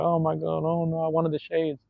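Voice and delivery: hammed-up crybaby kid; mock crying kid. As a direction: imitate a kid whimpering over lost sunglasses.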